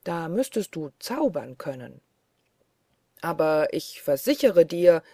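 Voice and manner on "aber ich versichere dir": mildly indignant, raised volume; indignant call. A narrator's reading. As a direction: insist loudly, with restrained annoyance. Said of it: "rief Michel unmutig". (unmutig gerufen)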